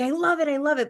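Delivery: delighted gush. Retold or imitated. Clients' delighted reaction retold.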